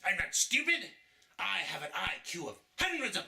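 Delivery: high indignant outburst; offended outrage. Acted. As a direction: bristle at the insult. Villain offended by insult.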